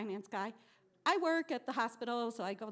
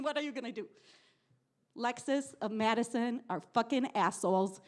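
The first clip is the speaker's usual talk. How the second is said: ordinary delivery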